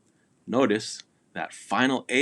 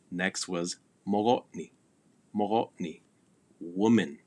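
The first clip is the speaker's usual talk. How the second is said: ordinary talk, nothing marked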